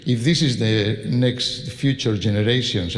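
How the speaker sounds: ordinary delivery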